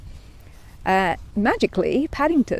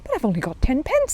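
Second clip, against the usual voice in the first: higher bear voice, a touch sad; 8 semitones higher than usual. A narrator's reading. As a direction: say it sadly as the bear. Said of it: "said Paddington sadly".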